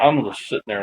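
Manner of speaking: ordinary talk, nothing marked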